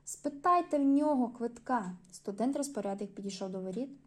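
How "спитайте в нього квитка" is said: called out loudly from the audience; shout from crowd. Acted. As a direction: yell it from the back, a bit slow. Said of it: "Хтось кричав з аудиторії".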